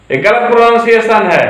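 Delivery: usual speaking voice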